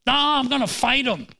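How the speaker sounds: put-on high-pitched, indignant child's protest; mock childish outburst; hot-headed child. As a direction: yell back like a kid spoiling for a fight. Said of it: "your child is hot".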